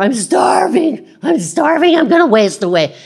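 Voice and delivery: high, loud whiny complaint; mock starving whiner. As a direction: ham up a hungry complainer. Mocking a hungry complainer.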